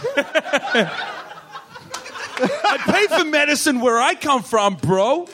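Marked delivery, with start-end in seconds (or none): 2.4-5.3 high, put-on American bro voice; hammed-up American accent, laughing underneath. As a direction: protest like an indignant American mate. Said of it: "Can you do his accent?".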